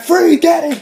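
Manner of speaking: high, loud retold chase order; acted-out urgent cop yell; police shout. Acting cop yelling at runner.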